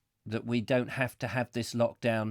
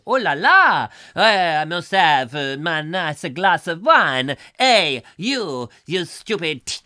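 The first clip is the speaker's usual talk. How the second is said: loud, hammed-up French accent; bad French accent; 6 dB louder than usual. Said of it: "my bad French accent".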